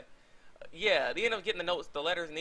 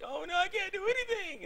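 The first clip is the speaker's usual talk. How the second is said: much higher falsetto, hammed-up helplessness; helpless girly squeal. Cornered Sakura crying for help, hammed-up high falsetto. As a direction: play the damsel in a squeaky put-on voice.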